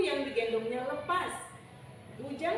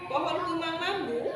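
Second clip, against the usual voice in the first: usual speaking voice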